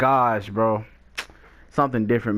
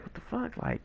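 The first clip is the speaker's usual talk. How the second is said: much softer, breathed out in shock; stunned whisper; 12 semitones higher, 14 dB quieter than usual. Retold or imitated. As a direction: whisper it, baffled. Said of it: "So I'm just like".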